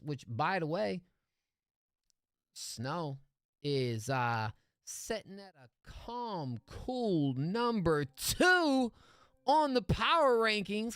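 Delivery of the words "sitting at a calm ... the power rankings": drawn out, high and loud hype; hype announcement. Real, not acted. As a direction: stretch out cool number two like an announcer. Hyping a rank on the chart.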